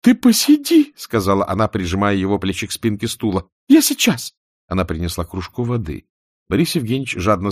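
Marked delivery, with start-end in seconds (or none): 0.0-0.9 high gentle woman's voice; Katya's voice. A narrator's reading. Katya soothing husband. 3.7-4.3 high gentle woman's voice; Katya's voice. A narrator's reading. Katya soothing husband.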